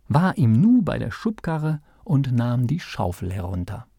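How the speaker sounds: plain reading voice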